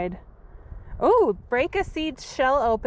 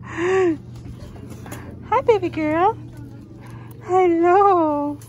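gushing pet greeting; 8 semitones higher, slower than usual. Real, not acted. Greeting her dog fondly.

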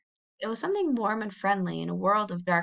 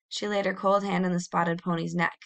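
plain reading voice

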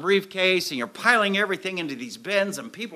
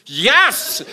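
higher and louder, a joyful yes; relieved yes; 6 semitones higher, 10 dB louder than usual. Retold or imitated. Answering the agent holding his license, overjoyed. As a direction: shout it out with relief.

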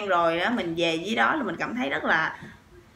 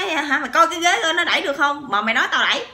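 higher and louder, fading at the end; heated retort; 7 semitones higher, 5 dB louder, faster than usual. Retold or imitated. Husband arguing about the bus seat, acted shouting. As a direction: bark the challenge, then cool off explaining. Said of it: "nổi máu côn đồ lên".

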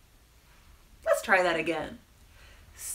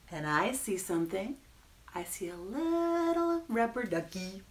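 ordinary delivery

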